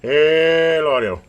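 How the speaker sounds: loud, drawn-out radio test call; calling out to check the transmit audio; mic check call. Real, not acted.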